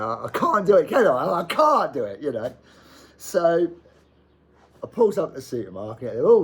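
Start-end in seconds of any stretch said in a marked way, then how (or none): 0.0-2.2 dismayed. Retold or imitated. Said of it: "I like".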